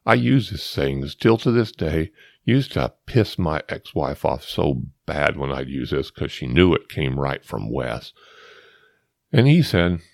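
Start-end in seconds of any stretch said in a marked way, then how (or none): none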